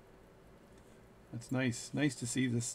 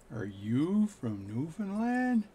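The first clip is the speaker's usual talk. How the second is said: slow, higher bartender, words drawn out; speaking really slow; 5 semitones higher, much slower than usual. Retold or imitated. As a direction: ask slowly as if to a foreigner. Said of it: "speak really slow".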